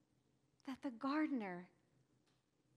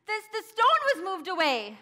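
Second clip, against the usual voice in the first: frantic high shout to the disciples; screaming for help; 10 semitones higher, 12 dB louder than usual. Acted. Mary screams to the disciples that the tomb is open. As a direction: scream their names out of breath. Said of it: "and I screamed".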